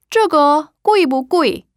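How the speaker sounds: 这个贵不贵 said slowly, high, with clear tones; 这个贵不贵 spaced syllable by syllable; Mandarin model sentence. Shown as a demonstration. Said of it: "이거 무슨 뜻이라고요".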